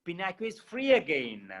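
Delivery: ordinary delivery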